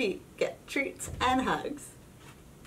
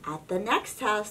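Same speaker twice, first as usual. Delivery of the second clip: plain reading voice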